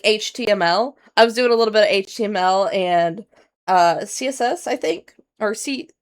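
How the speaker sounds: ordinary talk, nothing marked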